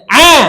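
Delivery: Ah! keras dari tenggorokan tengah; huruf ain dari tenggorokan tengah. Shown as a demonstration. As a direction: ucapkan Ah! dari tenggorokan, nyaring. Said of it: "Coba, satu, dua, tiga". (Ah! lantang dari tenggorokan tengah)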